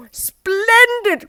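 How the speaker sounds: very high, loud Splendid; Mister Forgetful crying out in delight, very high; excited cry. Acted. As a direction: burst out with delight. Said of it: "cried Mister Forgetful".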